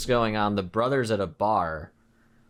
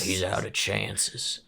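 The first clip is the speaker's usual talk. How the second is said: slow, quiet rasp; raspy sheriff; 2 semitones lower, 4 dB quieter, slower than usual. Acted. Small-town sheriff refusing more chances, raspy voice. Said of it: "really raspy voice".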